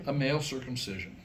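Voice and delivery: usual speaking voice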